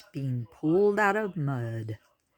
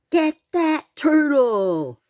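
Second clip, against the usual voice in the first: high, loud, slow command; barked order; 11 semitones higher, 8 dB louder, slower than usual. A narrator's reading. A pig orders the others to grab the turtle, loud and high. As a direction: order the mob to attack. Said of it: "a pig to order".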